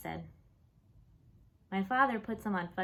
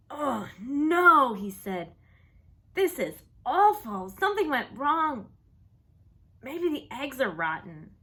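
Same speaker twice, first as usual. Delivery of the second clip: loud, higher, slowed cry of dismay; dismayed outcry; 5 semitones higher, 8 dB louder than usual. Acted. Father spitting out his own omelet, loud dismay. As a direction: cry out in horror at the taste.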